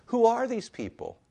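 high, loud quoted question; preacher's high-pitched dramatic asking; rhetorical wondering. Acted. Repeats the question from Revelation.

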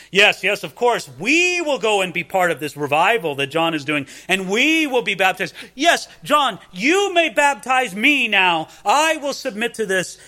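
loud, showy mock eagerness, rising high; ostentatious Pharisee. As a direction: ham up the pious show-off. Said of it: "do it in this ostentatious way".

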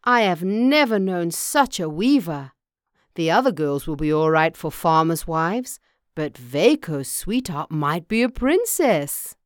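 loud, emphatic farmer full of praise; impressed farmer. Acted. Farmer praises the unknown weaver, loudly impressed. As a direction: play a father loudly marvelling.

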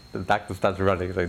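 normal talking voice

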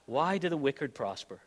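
soft, low first asking of why; quiet question. Acted. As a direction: ask it softly, almost to yourself. Repeats the psalmist's question.

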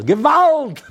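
sudden high, very loud cry; full yell. Retold or imitated. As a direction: scream it out like a woman in labor. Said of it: "he hears a yell".

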